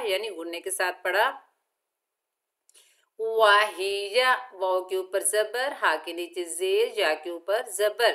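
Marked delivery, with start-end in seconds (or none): 3.2-4.5 वाहिया with short vowels and a heavy ha. Shown as a demonstration. Teacher reciting वाहिया slowly for students. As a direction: recite वाहिया without stretching. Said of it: "तीनों को हमने बगैर खींचे पढ़ना है".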